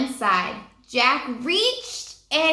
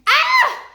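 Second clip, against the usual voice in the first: high, loud startled scream; a sudden piercing scream; 7 dB louder than usual. Acted. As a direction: scream at the snake. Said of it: "Jack screamed".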